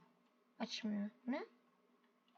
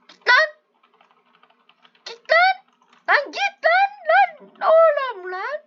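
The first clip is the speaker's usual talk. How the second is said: much higher, loud furious yells; angry shouting; 12 semitones higher, 5 dB louder, slower than usual. Real, not acted. Gamer shouting at the goat pushing him out, furious. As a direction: shout the rival away.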